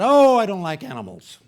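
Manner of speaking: sudden loud, high-pitched protest; blurted objection. Retold or imitated. Woman refusing her friend's pet-sitting offer, loud high blurt.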